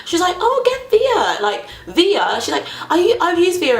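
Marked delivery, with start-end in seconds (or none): 0.4-2.4 high, excited recommendation, imitated; imitating friend. Friend Lexi recommends the app. 2.9-3.8 higher, eager friend's voice; imitating friend. Friend Lexi recommends the app.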